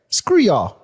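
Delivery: ordinary talk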